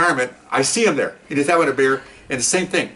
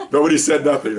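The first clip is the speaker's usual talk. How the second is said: laughing through the punchline; real laughter over the line. Real, not acted. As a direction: chuckle out the words.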